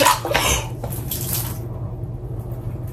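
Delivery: sharp gasp at a spill; startled gasp. Real, not acted. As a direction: gasp in surprise. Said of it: "I just spilled water all over myself".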